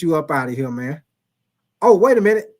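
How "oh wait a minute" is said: loud, sudden wait a minute; sudden surprise. Real, not acted. Host noticing he removed the wrong viewer, surprised. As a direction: catch yourself, startled.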